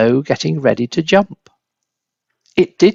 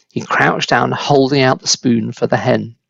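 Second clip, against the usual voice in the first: ordinary delivery, nothing marked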